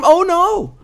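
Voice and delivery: high, loud mock gasp of alarm; hammed-up horror; mock shock.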